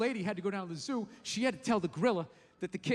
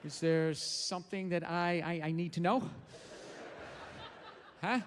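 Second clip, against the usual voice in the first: lower, hesitant gorilla asking what's wrong; slow down, unsure what's wrong; worried gorilla; 5 semitones lower, 4 dB quieter than usual. Pretend. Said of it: "looking at the lady like".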